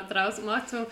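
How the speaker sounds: usual speaking voice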